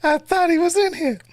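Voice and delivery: squealing with glee at the thought; excited. Real, not acted. Host hoping a rival joined the stream, giddy. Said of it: "I got excited".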